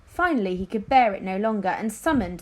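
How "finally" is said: ordinary delivery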